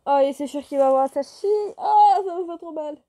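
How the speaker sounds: loud, anguished reaction to the heartbreaking scene; loud pained outcry. Real, not acted. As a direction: cry out that it hurts. Reader reacting to painful scene.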